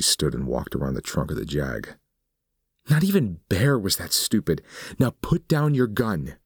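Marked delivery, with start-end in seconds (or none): 2.9-6.4 much higher, contemptuous woman's voice; scornful woman. Acted. Suzanne mocks Nick for trusting her: scornful, higher. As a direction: sneer down at him.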